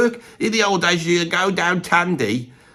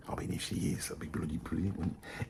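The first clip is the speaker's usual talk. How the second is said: hushed, secretive whisper; whispered aside; 10 semitones lower, 19 dB quieter than usual. Real, not acted. Whispering a hope that Toya might be interested. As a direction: whisper it like nobody else should hear.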